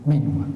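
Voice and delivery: "Menua" with an open first e; "Menua" with the old "ae" as one open e. Shown as a demonstration. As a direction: say "Menua" low, opening the e. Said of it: "algo así, ¿no?".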